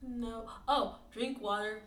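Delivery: ordinary talk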